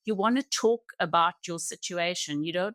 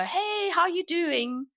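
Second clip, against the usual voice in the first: mock breezy hello, much higher and drawn out; flippant greeting; 11 semitones higher, slower than usual. People greeting a grieving mother, flippantly. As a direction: imitate a careless, sing-out greeting. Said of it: "the flippant words".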